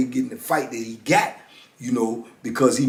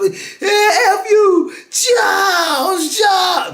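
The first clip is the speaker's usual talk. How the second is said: high, loud furious scream; 4 dB louder, much slower than usual. Retold or imitated. Inmate cursing Charles over the guards, high furious scream. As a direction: hurl it at him down the tier.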